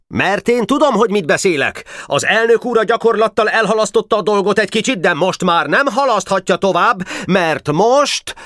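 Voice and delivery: high, strident indignant rant; shrill accusing boy's speech; yelling orator. A narrator's reading. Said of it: "ordította szónok".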